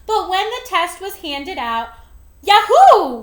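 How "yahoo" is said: loud, high cheer; yelled yahoo. A narrator's reading. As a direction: whoop it out with joy. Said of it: "we yelled".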